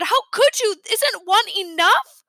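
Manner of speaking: high and loud, dragging the last question; shouting. A narrator's reading. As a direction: keep yelling at them. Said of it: "I kept right on shouting".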